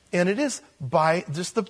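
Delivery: ordinary talk, nothing marked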